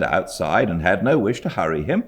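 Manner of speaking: usual speaking voice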